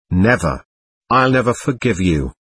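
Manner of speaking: ordinary delivery, nothing marked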